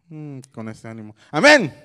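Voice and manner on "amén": loud call for an amen; raised, prodding call-out; rousing call. Real, not acted. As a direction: get the crowd to answer. Prods a weak crowd to answer.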